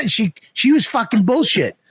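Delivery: ordinary talk, nothing marked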